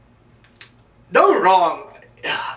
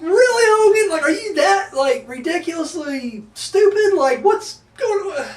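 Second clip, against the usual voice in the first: much higher, slowing down in disbelief; mock the wrestler for being so dumb; exasperated mock scolding; incredulous; 10 semitones higher than usual. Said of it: "So I'm like".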